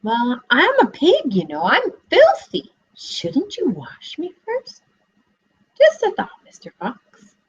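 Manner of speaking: high, slow, sweetly sly piglet voice; coy piglet. Acted. Piglet talking the fox into washing him, coy.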